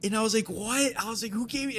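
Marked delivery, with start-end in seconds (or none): 0.5-0.9 high-pitched excited disbelief; elated. Retold or imitated. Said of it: "and I was, like, elated". 1.3-1.8 high, excited retold question; elated. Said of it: "and I was, like, elated".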